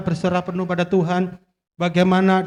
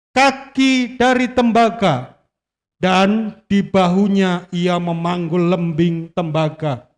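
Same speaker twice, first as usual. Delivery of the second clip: slow, weighty Bible reading; dramatic scripture reading; 5 semitones higher, slower than usual. Acted. Preacher reading Goliath's description to the church, slow and grand. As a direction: read it slowly like a grand tale.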